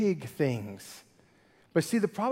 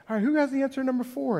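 higher, quick classroom question; lightly voiced teacher quizzing the class; 7 semitones higher, much faster than usual. Teacher calling on students who avoid eye contact, lightly acted. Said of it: "like, as a teacher".

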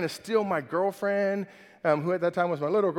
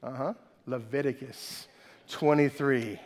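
usual speaking voice

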